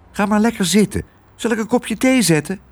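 put-on high, coaxing child voice; soothing girl's voice. Girl offering tea to her raging father, high soothing voice. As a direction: coax him kindly in a high voice.

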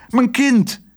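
high, emotional cry; heartfelt high-pitched call. A narrator's reading. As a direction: exclaim to your child, pitch right up. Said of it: "riep hij".

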